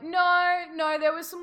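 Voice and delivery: high, loud "no" of a fibbing daughter; hasty denial. Acted. Daughter denying to her mother.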